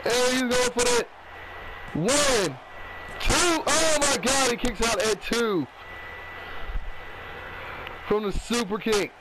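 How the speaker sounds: lively ringside play-by-play; wrestling commentator. Acted. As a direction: call the match as it happens. Live play-by-play of the match.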